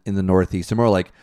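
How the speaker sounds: normal talking voice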